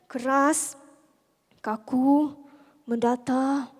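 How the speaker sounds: each word set apart, rigid and toneless; stiff, hard monotone. Shown as a demonstration. Speaker mocks rules for how women must communicate, stiff and monotone. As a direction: mimic a rigid, expressionless speaker. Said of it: "Dia kata mesti kena keras, kaku, mendatar".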